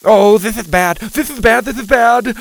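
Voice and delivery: loud, high, frantic; panicking. A narrator's reading. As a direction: repeat it in panic. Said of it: "Mac cried".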